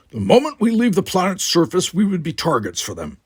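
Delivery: urgent. Acted. Worf urgent.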